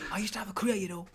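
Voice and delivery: attempted Scouse accent. Mocking a washed-up Brookside actor crying, bad Scouse accent. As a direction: have a poor go at Scouse. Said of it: "I can't do a Scouse at all".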